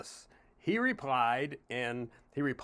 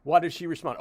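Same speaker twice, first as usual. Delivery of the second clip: ordinary delivery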